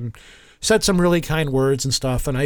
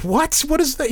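baffled surprise; 11 semitones higher than usual. Retold or imitated. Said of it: "we're looking at her like".

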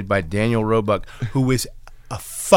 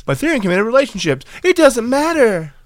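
fast, higher mocking voice; mock snooty voice; 10 semitones higher, faster than usual. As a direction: rattle it off in a high mock voice. Mocking voice continues.

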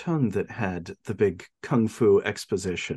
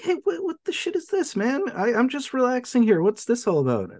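much higher, indignant surprise; mock startled Ichi; 13 semitones higher than usual. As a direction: play it as an annoyed, startled protest. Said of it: "he goes past him like".

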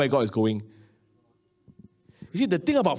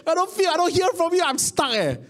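higher, strained cry to God; retold desperate prayer, rising high; frustrated pleading prayer; 7 semitones higher than usual. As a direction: plead in frustration, pitch climbing. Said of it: "I told God, I said".

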